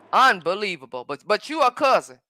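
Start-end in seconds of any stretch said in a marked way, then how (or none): none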